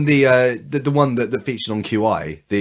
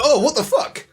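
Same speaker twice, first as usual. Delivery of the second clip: shocked outburst. Retold or imitated. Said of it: "they're just like".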